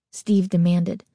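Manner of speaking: ordinary delivery, nothing marked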